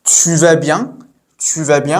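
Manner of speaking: «Tu vas bien?» with a clear rise on bien; rising question intonation. Shown as a demonstration. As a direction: make «bien» climb at the end as a question. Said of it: "which is rising".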